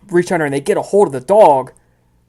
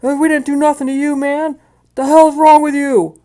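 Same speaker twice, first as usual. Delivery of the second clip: high, loud indignant put-on voice; mad street urchins; 13 semitones higher, 6 dB louder than usual. Game master as woken inhabitants confronting thugs, high angry protest. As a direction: protest angrily in a high character voice. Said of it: "and they look mad".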